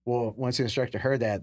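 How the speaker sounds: ordinary talk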